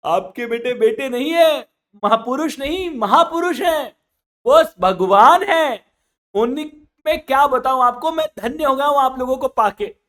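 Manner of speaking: loud, high weeping exclamation; crying praise. Acted. Punjabi weeping as he praises father baniya's sons. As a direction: wail the praise through tears. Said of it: "रोते रोते बोलता है".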